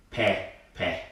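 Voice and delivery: "pe pe" low and soft, open "eh"; accented vowel. Shown as a demonstration. Teacher introduces the word with the accent, "pe pe" as peh-peh. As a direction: say "pe pe" as peh-peh, dropped in pitch. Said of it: "with the accent, it's a different word".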